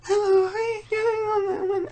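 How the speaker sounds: man on a bus doing a lady character's voice; imitated lady voice. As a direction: put on a high falsetto old lady greeting. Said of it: "a lady who was like".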